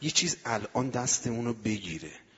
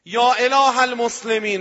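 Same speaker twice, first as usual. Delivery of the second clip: loud, high, drawn-out invocation; ringing, raised call in prayer; calling out to God; 11 semitones higher, 12 dB louder than usual. Retold or imitated. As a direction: cry out slowly to heaven.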